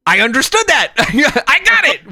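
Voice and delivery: high, triumphant little outburst; acted excitement. Acts proud reaction to understanding Spanish.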